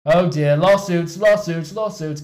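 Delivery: loud, high-pitched panic; flustered, high outcry; panicked fretting. Acted. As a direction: blurt it in rising alarm. Boss panics about lawsuits.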